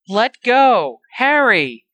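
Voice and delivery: loud, high cries while struggling; desperate shouting. A narrator's reading. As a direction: yell to break free and reach him. Restrained friend fights to reach Harry.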